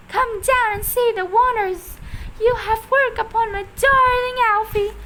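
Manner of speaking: high, loud thrilled cry; excited cry. A narrator's reading. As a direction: cry out in delight. Mrs Silver shouting up, thrilled.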